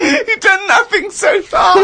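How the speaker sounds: high, slow cry of laughter; laughing shout. Real, not acted. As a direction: shout it through tears of laughter. Laughing at the diary.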